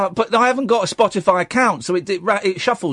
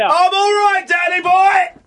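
high-pitched Cockney holler; loud, pitched-up Cockney banter; Cockney shouting; 12 semitones higher, 4 dB louder, slower than usual. Acted. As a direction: shout a matey Cockney greeting. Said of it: "stop the Cockney shouting".

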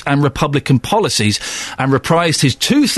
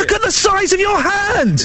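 high, slow cry of astonishment; real disbelief, pitched way up; amazed. Real, not acted. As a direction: marvel at the size of it. Amazed at a cricketer's hand.

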